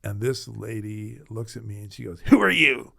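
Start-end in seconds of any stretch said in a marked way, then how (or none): 2.3-2.9 louder and higher, a sharp challenge; a dying woman snapping at a visitor; gruff demand. Retold or imitated. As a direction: bark it at the stranger. Angry patient confronts young pastor.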